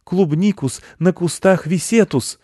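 high, slow spell casting; magic incantation. Acted. Boy wizard making strawberries stay on bushes, incantation. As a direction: chant the made-up spell slowly.

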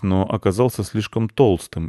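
ordinary delivery

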